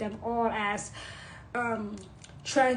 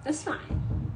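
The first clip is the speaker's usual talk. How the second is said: whisper; 4 semitones higher than usual. Real, not acted. Whispered aside.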